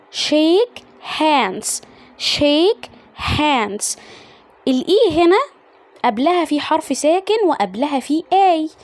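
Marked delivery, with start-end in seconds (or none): none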